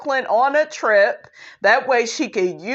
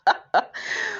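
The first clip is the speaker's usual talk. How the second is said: high giggle; 10 semitones higher, 5 dB quieter than usual. Real, not acted. Cracking up at Marlo's line.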